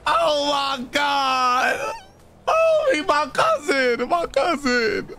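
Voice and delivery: drawn-out, high cries of grief; anguished wail. Real, not acted. Player sees his cousin dead, anguished wailing.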